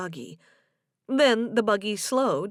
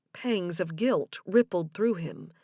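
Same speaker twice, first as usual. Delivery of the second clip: usual speaking voice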